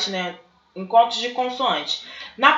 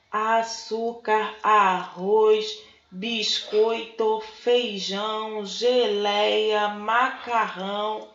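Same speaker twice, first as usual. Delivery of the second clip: soft, deliberate dictation with every syllable separated; slow, syllable by syllable; 4 dB quieter, much slower than usual. Acted. Snake's wife dictating groceries after being asked to slow down, slow syllable-by-syllable delivery. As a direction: spell out each item slowly, pausing between syllables. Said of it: "que estava falando palavras pausadamente".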